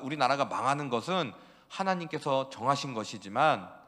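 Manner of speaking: ordinary talk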